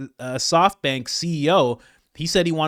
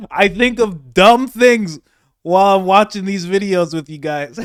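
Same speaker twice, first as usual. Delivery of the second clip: laughing aloud; 6 semitones higher, 10 dB louder than usual. Real, not acted. Laughing at his own silly idea.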